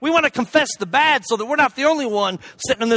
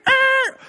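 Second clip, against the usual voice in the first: high imitated tire squeal; sharp, high screech noise; skidding tire screech; 14 semitones higher than usual. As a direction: mimic a truck skidding. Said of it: "That Toyota's skipping".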